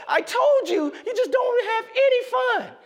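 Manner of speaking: put-on high, loud, sulky little boy; whiny child gloating. Son telling dad he told him so, high loud whine. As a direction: rub it in like a sulky kid.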